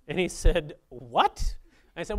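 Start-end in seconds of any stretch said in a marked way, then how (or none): 0.9-1.6 loud, incredulous retold reaction; taken aback. Lender reacting to a huge loan request, taken aback. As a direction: blurt it out as the startled lender.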